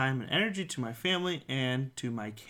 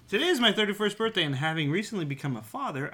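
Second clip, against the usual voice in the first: fast reading; 5 semitones higher, much faster than usual. Real, not acted. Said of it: "it reads".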